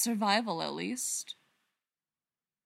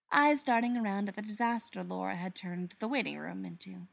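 usual speaking voice

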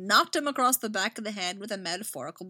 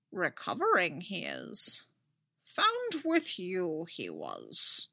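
slow, odd little Yoda voice; 3 dB quieter, slower than usual. Acted. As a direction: croak it out like old Yoda, slowly. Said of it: "the unmistakable voice of Yoda".

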